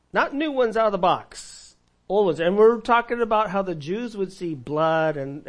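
usual speaking voice

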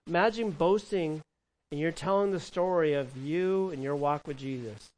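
ordinary delivery

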